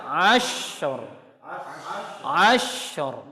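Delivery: "Ashur" with the sh drawn out one beat; flowing ashur. Shown as a demonstration. Teacher showing one harakah of flow. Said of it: "Alirkan dulu, satu harokat".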